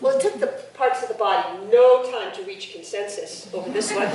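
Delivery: plain reading voice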